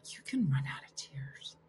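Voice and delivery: soft whispered aside. Real, not acted. Host marvelling at a viewer's comment, whispered. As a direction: breathe it as a private marvel.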